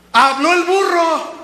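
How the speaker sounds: high, loud, slow proclamation; emphatic exclamation. Real, not acted. Preacher driving the saying home to congregation, loud exclamation. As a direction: cry it out slowly.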